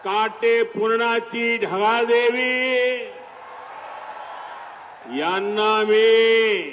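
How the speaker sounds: loud sing-song rally greeting; roaring rallying speech. Real, not acted. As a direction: address a huge crowd from the stage. Rally greeting to Akola crowd.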